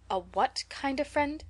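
baffled, slow question; she's never heard the word; slow, puzzled elder woman. Acted.

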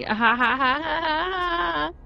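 staged laugh climbing high; hammed-up fake laugh. Player voicing the game's letter, hammed-up rising laugh. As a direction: force out a laugh that rises as it goes.

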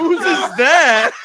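high, loud, slow and incredulous; stunned, stretched-out shout; shocked outcry. Real, not acted. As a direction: yell it in laughing disbelief. Shocked at the drink handed over.

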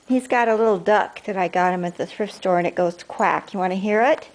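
ordinary talk

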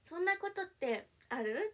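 high, slow, cutesy pouty whine; fake cute voice. Shown as a demonstration. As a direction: act super cute and pouty. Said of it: "めっちゃぶりっ子で言ってみる？".

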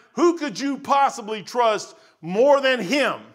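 loud, high preaching build. Acted. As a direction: drive the point home to the crowd.

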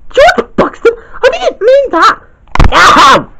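high and slow, then a yelp; the girl cries out and scolds George. Acted. Girl figure hurt by toy.

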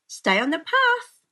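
high, loud warning from a mother; mother's warning. A narrator's reading. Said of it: "warned her mother".

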